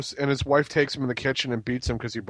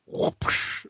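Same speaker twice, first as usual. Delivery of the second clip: whooshing sound effect; 5 dB quieter than usual. Retold or imitated. Imitating a quick wrestling win.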